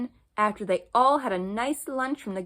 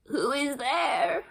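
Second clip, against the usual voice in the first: slow, higher granny voice; old grandmother; 5 semitones higher, much slower than usual. Acted. Grandmother calling from her bed, slow and frail.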